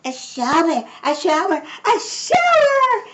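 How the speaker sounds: high cries climbing to a squeal; children screaming. A narrator's reading. Four children jump into the shower, screaming. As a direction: build to a long, high scream. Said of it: "screamed Missus Ralph's four children".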